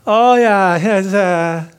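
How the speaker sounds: loud, high, hammed-up reassurance; put-on friendliness. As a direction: gush it loudly, obviously fake. Acting out forgiving only with face.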